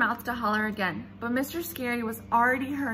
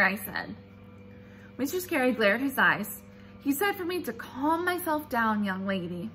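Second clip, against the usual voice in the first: ordinary delivery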